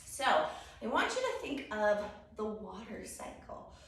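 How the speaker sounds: normal talking voice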